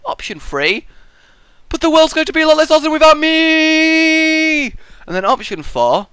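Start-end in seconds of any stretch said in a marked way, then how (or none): 1.7-4.7 much higher, louder, grandiose last words; mock self-important farewell; hammed-up boast. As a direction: proclaim it like a vain hero.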